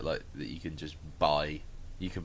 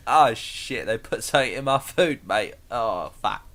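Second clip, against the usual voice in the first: loud mock London panic; hammed-up London poisoning victim; proper London accent; 4 semitones higher, 7 dB louder than usual. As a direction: swear like a broad Londoner. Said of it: "Just some proper London accent".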